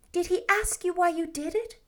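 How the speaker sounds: lightly voiced anxious young woman; Anne, flustered girl. A narrator's reading. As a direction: hurry to mend it. Anne asks.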